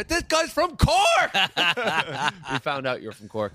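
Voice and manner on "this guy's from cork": high, loud, drawn-out mock yell; hammed-up indignant holler; outraged accusation. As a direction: cry it out high, stretching CORK. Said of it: "and he go".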